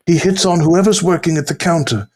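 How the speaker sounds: lifted, hushed wildlife narrator; nature documentary narrator. Retold or imitated. Continuing the nature documentary bit.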